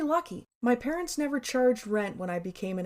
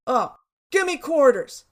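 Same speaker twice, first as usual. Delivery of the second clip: loud, higher mock demand; impatient customer; 4 semitones higher, 7 dB louder than usual. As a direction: cut in loudly like a customer. Customer cutting the cashier off.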